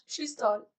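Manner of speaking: ordinary delivery, nothing marked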